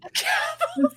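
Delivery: high squeal of laughter on the word. Real, not acted. Cohost repeats the made-up word gavelin, squealing with laughter. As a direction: crack up as you repeat the silly word.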